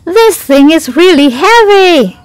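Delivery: struggling bird character, high and loud; straining complaint. Acted. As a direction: complain loudly about a heavy load. Crow struggles with heavy pillow.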